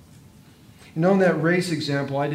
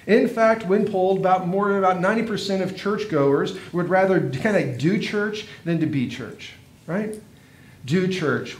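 preaching; 3 semitones higher than usual. Acted. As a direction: drive the point home to the congregation. Preacher builds and presses the point.

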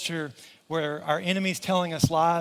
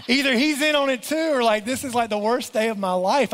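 higher-pitched mock panic; retold freaking out; 5 semitones higher, 4 dB louder than usual. Pastor retelling his dread after detective's call, mock panicked. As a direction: retell it as a rising, comic freak-out. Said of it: "riding down the road freaking out".

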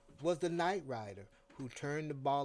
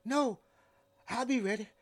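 quick reply; 8 semitones higher, 7 dB louder, faster than usual. A narrator's reading. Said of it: "I said quickly".

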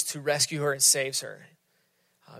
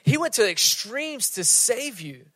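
incredulous pleading; 4 semitones higher than usual. Retold or imitated. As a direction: push in, amazed she forgot. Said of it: "We're like".

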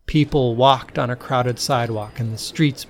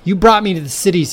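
fast, loud, higher accusing shout; accusing yell; 7 semitones higher, 7 dB louder, faster than usual. A narrator's reading. Todd to Kate about the cities, fast accusing yell. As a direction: hurl the blame at her, fast. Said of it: "he yelled".

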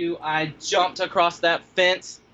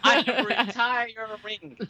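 emphatic, higher, dragged-out declaration; mock exasperated vow; 7 semitones higher, much slower than usual. Retelling quitting dog-sitting, mock exasperation. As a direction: draw it out as a comic final vow. Comic retold vow to quit.

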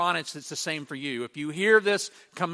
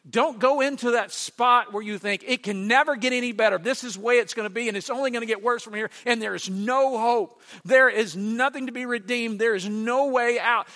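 louder, higher preaching urgency; fervent warning; 7 semitones higher, 5 dB louder than usual. Retold or imitated. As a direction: drive the warning home with force. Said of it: "He's going".

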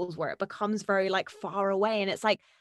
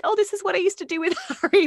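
excited quote; 9 semitones higher, 5 dB louder, faster than usual. Retold or imitated. Quoting her excited client.

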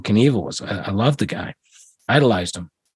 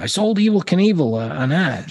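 excited boast; 8 semitones higher than usual. Retold or imitated. Quoting his own boasting.

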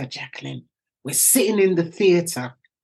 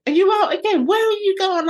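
put-on suspicious kids; 13 semitones higher, faster than usual. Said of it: "they were just like".

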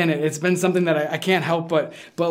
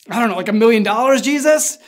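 blurted out; 4 semitones higher, 4 dB louder, faster than usual. Retold or imitated. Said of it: "I'd be like".

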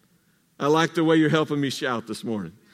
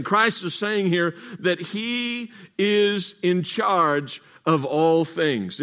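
ordinary delivery

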